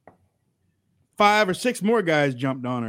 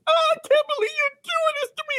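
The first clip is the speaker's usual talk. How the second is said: squeaky fast whine imitating her; high, fast fake distress; high-pitched mock cry; faster than usual. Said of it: "she was yelling".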